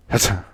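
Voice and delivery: a small muffled sneeze. Acted. A man sneezes in a bar, small muffled sneeze. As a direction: hold the sneeze back. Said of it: "Did you just sneeze?".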